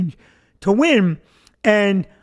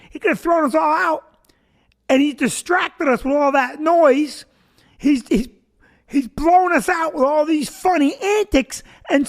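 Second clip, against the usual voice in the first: mock accusation; 6 semitones higher than usual. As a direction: accuse him like a cheated crowd member. Voices the crowd accusing him.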